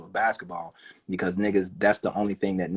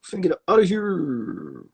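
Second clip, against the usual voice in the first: sing-song sign-off; 7 semitones higher than usual. Real, not acted. Host signing off to listeners, higher sing-song voice. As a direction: sing it out as a playful goodbye. Wrapping up the show.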